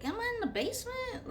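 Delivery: high, fast bewildered question; confused. Retold or imitated. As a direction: squeak it out in disbelief. Confused question in retold story.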